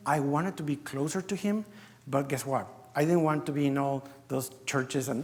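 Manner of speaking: normal talking voice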